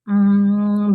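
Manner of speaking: ordinary talk, nothing marked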